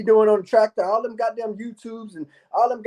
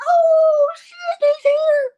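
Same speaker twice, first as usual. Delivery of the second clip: very high, loud shocked cry; shocked fans; 5 dB louder, much slower than usual. Pretend. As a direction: cry out like you just saw him arrive. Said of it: "everybody's gonna be like".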